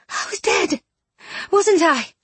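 breathy, dazed woman rising high; dazed realisation. A narrator's reading. Bronte realising she was dead, dazed.

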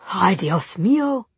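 slow exasperated Spanish sigh; a tutting Spanish ay; Spanish exclamation. A narrator's reading. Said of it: "slipping into Spanish".

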